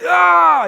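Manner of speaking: loud, high cry of pain; pained yell. Retold or imitated. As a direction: let out a full-voiced "Ah!" as if burned. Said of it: "And I yell".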